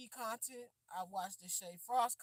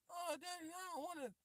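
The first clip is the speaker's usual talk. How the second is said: put-on whiny child; 8 semitones higher, 11 dB quieter than usual. Child voiced in story.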